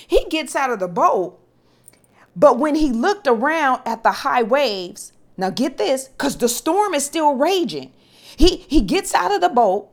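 fired-up preacher climbing in pitch; preaching at full force. Acted. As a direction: build the congregation up.

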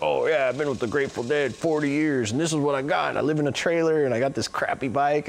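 put-on bragging old roadie. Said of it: "he was bragging".

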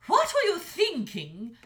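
loud, high scolding dragon mother; angry mother dragon. A narrator's reading. Mother dragon scolding her son, loud and high. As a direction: tear into her son for his foolishness. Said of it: "asked the dragon's mother".

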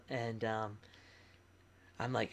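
normal talking voice

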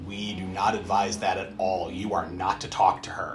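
low, firm mock-lawyer voice; stern lawyer. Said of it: "And the lawyer goes".

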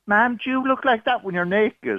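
a hint of an innocent little girl; child's question. Caller retelling daughter's question to her mother, lightly voiced child. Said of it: "she turned to her mother and she said".

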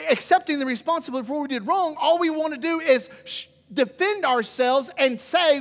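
usual speaking voice

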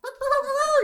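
high-pitched imitated rooster crow. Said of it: "you hear the rooster crow".

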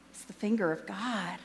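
soft, solemn pronouncement; hushed awe. Retold or imitated. Film character answering about the tornado, hushed and grave. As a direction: let it drop to a reverent hush.

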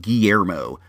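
low Guillermo in a put-on accent. Shown as a demonstration. Host practising a word he struggles with, accented Guillermo. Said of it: "if you use an accent like that".

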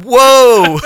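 big, high, loud shocked cry; hammed-up recoil, voice leaping up; shocked whoa. Dad finding his toddler's steaming pile, shocked whoa.

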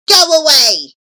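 loud, high shout; one forceful, alarmed yell. A narrator's reading. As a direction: shout to drive off a devil. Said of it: "shouted the charcoal burners".

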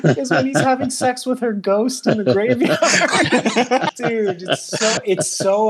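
high-pitched laughing through words; giggling while telling it. Real, not acted. Laughing while describing scene.

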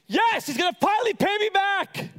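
high, acted burst of triumph; excited relief. Lender at dinner expecting his money back, excited. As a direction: celebrate inwardly at full pitch. Acting his hopeful inner reaction.

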